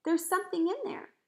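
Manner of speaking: high, loud exclamation; excited cry. A narrator's reading. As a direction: cry out the discovery. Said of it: "cried out".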